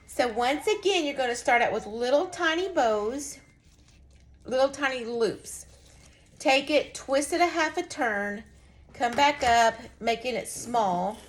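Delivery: usual speaking voice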